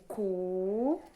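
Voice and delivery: teacher repeating "Cu" loudly for the class; isolated syllable. Shown as a demonstration. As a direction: stretch "Cu" into a rising beat. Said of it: "Eu abri a boca cinco vezes".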